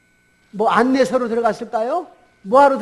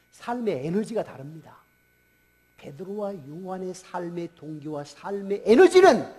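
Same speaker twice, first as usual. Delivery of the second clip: usual speaking voice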